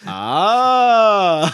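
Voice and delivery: loud, high swooping long "ah"; hammed-up sustained fake scream; mock scream. Co-host copying the actress's scream, loud rising mock "ah".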